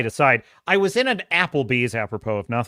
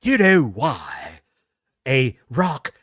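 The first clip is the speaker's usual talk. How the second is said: slow, low, over-articulated posh English; melodramatic posh English; 7 semitones lower, slower than usual. Retold or imitated. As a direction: labour over each posh English syllable. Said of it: "muscles in his mouth just flexing with effort".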